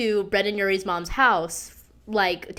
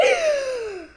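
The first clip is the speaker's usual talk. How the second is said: high gasp. Real, not acted. Cringing at her own story.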